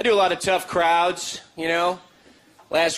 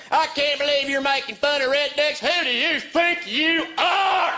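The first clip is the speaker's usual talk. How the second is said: high, slow offended lady voice; mock outraged church woman; indignant church lady; 10 semitones higher, slower than usual. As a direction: scold the comic from the pews. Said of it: "this lady gets up".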